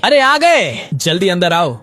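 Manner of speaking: high, loud welcoming call from inside. Acted. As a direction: shout a cheerful welcome through the door. Host calling from inside doorway.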